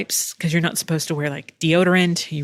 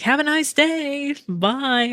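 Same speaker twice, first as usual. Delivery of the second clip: higher, slow, sing-song goodbye, lightly voiced; cheery doorman farewell; 9 semitones higher, slower than usual. A hint of the man in the waiting room playing doorman, slow and sing-song. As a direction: wave everyone off like the doorman. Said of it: "the guy with his mom is just like".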